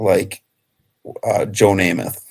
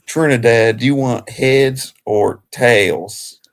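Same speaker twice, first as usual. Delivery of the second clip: slow, lightly hammed Southern drawl as a coin-toss ref; Southern accent; 4 semitones higher, 4 dB louder, slower than usual. Host playing a Southern coin-toss referee, light drawl. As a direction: drag the vowels, mock Southern official. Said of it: "that Southern accent".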